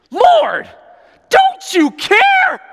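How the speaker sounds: high, loud exasperated shout, drawn out; ticked-off Martha. Acted. Martha complaining to Jesus about her sister, ticked-off shout. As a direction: wail it at full volume, fed up. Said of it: "She's just ticked".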